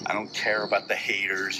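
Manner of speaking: ordinary talk, nothing marked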